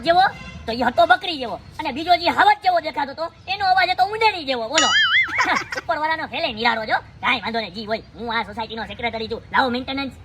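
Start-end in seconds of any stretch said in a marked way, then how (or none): 4.9-5.7 short shrill laugh, very high; high squeal laugh. Real, not acted. Laughs at odd voices. (તીણું હાસ્ય)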